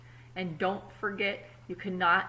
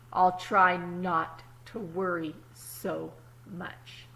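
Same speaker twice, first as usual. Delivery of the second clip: put-on calm storybook reading to kids; story narration; 3 dB louder than usual. A narrator's reading. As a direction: read it like a picture book at story time. Reads the story aloud.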